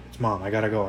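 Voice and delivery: ordinary delivery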